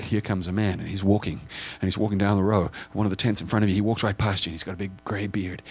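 ordinary delivery

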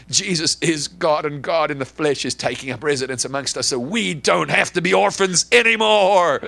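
fervent pulpit proclamation; preaching at full force. Acted. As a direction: drive it home to the congregation. Preaching climax.

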